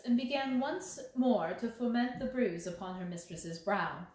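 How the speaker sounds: ordinary delivery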